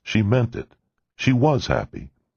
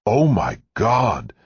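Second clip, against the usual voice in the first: slow, high, thrilled cry of joy; an overjoyed father-to-be exclaiming on the phone; joyful shout; 6 semitones higher, slower than usual. A narrator's reading. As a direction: call it out high and stretched with delight. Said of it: "he shouted at the other end".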